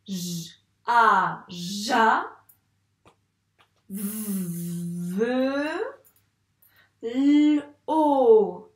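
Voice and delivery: "ja", "v", "lo" each said apart; sounding out words. Shown as a demonstration. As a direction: sound out "javelot" in pieces. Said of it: "Comment on lit ça?".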